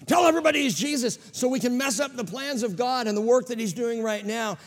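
high, fast, sing-song demon voice; scheming demon. Retold or imitated. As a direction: taunt like a gleeful demon. Demons voiced blowing Jesus' cover.

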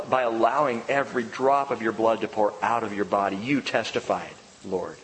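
hushed closing prayer to the Lord. Real, not acted.

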